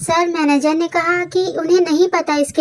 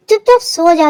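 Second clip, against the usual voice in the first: ordinary delivery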